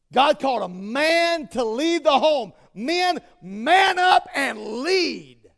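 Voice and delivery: loud, slow commanding call; preaching exhortation. Real, not acted. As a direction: demand it of the men.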